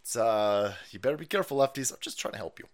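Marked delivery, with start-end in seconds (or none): 1.9-2.6 much softer, lower; quiet mock sincerity. Real, not acted. Streamer softly repeating his warning to lefties. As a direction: say it softly now. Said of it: "repeats calmly".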